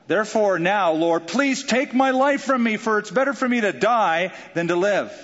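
despairing plea. Acted. Jonah begging to die.

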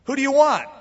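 Pilate calling loudly to the crowd; high, loud, put to the mob; called out question. Retold or imitated. Preacher as Pilate calls out to the crowd, loud.

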